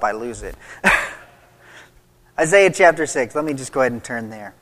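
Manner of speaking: usual speaking voice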